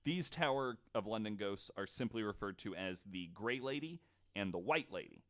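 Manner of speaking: usual speaking voice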